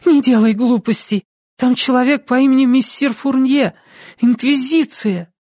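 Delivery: high-pitched pleading warning; urgent young woman. A narrator's reading. Lucy warning Ansel of the Inquisition, urgent high voice.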